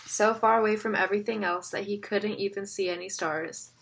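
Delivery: ordinary delivery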